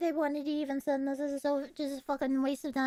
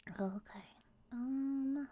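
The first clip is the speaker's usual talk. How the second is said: soft breathy whisper; hushed aside; 10 dB quieter, much slower than usual. Real, not acted. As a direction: drop to a whisper.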